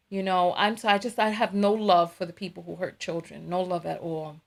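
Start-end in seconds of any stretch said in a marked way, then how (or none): none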